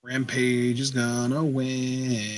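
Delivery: slow, soft sing-songy tease; sing-song taunt. Real, not acted. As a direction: draw it out in a teasing sing-song. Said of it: "saying it in a sing-songy voice".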